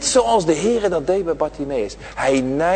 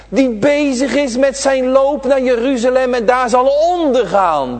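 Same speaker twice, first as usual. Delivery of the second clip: raised, ringing sermon voice; fervent preaching; 8 semitones higher, 4 dB louder than usual. Acted. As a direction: preach it out to the children, high and carried. Preacher at full force to children.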